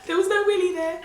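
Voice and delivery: high, loud and delighted; excited. Real, not acted. Mother telling viewers her baby was a girl, excited. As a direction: burst out with glee.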